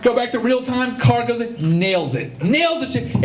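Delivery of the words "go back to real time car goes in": usual speaking voice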